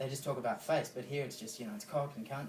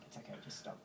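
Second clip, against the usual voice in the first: gentle whisper; 13 dB quieter, faster than usual. Retold or imitated. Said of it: "I'm like".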